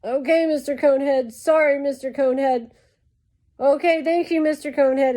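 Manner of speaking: imitated placating apology, high; Ronnie. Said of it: "Ronnie is like".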